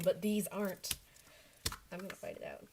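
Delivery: ordinary talk, nothing marked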